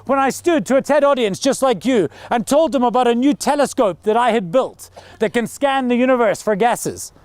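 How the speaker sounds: ordinary talk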